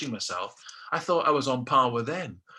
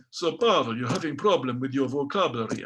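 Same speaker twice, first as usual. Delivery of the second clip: put-on Russian instructor's accented English. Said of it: "This is a Russian accent now".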